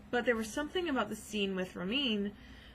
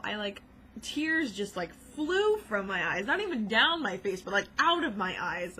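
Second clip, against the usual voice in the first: normal talking voice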